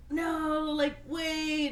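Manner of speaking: high, strained imitation of a beaten man; mock dying friend. Beaten friend stopping Dalton, mock high strained voice. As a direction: play a weak, high wounded buddy, half joking. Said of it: "and he's like".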